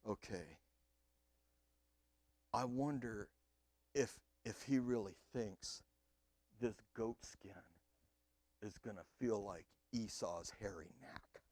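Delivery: nervous inner thoughts. Retold or imitated. Jacob fretting to himself while his father calls him close. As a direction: mutter it under your breath like a scared liar. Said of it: "He's going".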